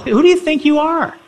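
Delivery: very high, incredulous demand; indignant demand. Acted. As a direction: demand an answer in mock outrage. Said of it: "They wanna know".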